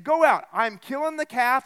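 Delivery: host calling out to his servants, loud and urgent; urgent command. Acted. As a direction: send them out now, loudly.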